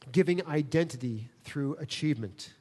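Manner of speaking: ordinary talk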